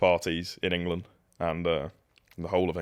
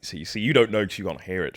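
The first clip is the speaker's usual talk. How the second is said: normal talking voice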